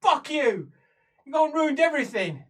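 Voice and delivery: high, loud accusation; really angry. Acted. Prisoner blaming her cellmate for ruining everything, furious. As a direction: hurl the blame at them. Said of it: "that means she's really angry".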